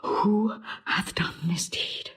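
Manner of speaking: hushed whisper. Acted. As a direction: whisper the question close to her. Emilia whispering to the dying lady.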